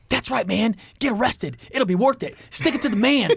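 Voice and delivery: high, punchy goading; egging-on inner voice. Retold or imitated. His brain talking to him, egging him on. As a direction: urge yourself on in short high bursts. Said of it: "that's my brain talking to me".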